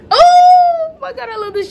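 very high, loud, slow squeal; real fangirl scream of delight; ecstatic squeal. Real, not acted. Loves the shirt.

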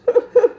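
high, loud burst of laughter; amused laugh. Real, not acted. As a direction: laugh out loud at your own story. Laughs at his own story.

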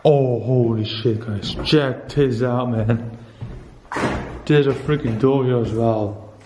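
usual speaking voice

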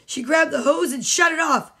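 ordinary delivery

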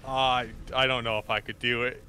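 ordinary talk

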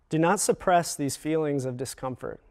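ordinary talk

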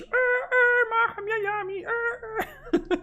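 high, slow, sing-song goofy voice; silly dubbed voice. Pretend. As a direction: dub a dumb cartoon character. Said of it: "Jakieś".

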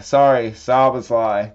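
ordinary talk

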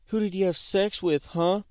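hammed-up angry mother grilling her son; mock angry mom. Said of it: "Asking me like".

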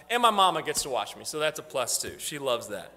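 usual speaking voice

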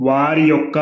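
ordinary talk

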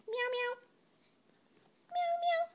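tiny, very high mews; playful high-pitched kitten imitation; kitten meow. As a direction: mew like a kitten. Kitten noise over picture book.

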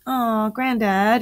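put-on mildly pleading groan of a kid; protesting exclamation. A narrator's reading. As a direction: whine it slowly to granddad. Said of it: "exclaimed Ethan".

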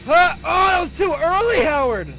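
loud high screams at a failed jump; frustrated scream. Real, not acted. Player screaming at partner Howard, loud and high.

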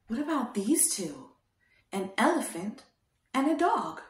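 normal talking voice

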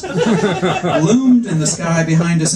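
chuckling through the words; laughing. Real, not acted. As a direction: laugh while reading. Narrator laughs mid-sentence.

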